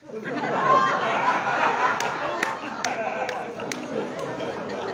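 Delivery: a breathy, high burst of laughter at the absurd excuse; disbelieving laugh. Real, not acted. Speaker to audience, laughing in disbelief at the excuse.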